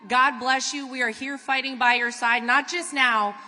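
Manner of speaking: usual speaking voice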